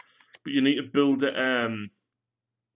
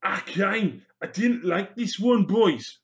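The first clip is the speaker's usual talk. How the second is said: high, loud sing-along Welsh accent attempt; 6 semitones higher, 4 dB louder, slower than usual. Welsh orc addressing his boys, hammed-up Welsh accent. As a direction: do a rough Welsh accent, pitched well up. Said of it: "That was supposed to be Welsh".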